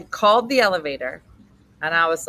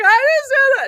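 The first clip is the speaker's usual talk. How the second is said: high, loud outburst; retold child's cry; 9 dB louder than usual. Lost kid wrapped round officer's leg, high cry. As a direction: imitate a worked-up kid crying out.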